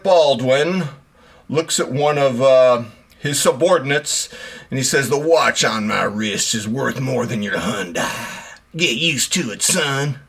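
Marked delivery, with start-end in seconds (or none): 5.1-10.2 a lightly done southern cartoon rooster voice; Foghorn Leghorn. Boss lecturing a subordinate, in a mock southern accent. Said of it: "I turned into Foghorn Leghorn".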